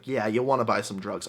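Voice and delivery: low, soft sales pitch; shady drug dealer. Acted. Street dealer to drunk tourist, shady low offer. Dealer persists after refusal.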